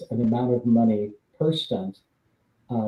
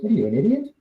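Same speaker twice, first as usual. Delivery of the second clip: mock disbelief, pitched much higher; incredulous scoff; 10 semitones higher, faster than usual. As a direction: scoff at the number like it's absurd. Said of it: "he looks at me and he goes".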